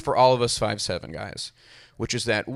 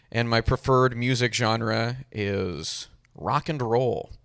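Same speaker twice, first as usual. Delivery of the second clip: normal talking voice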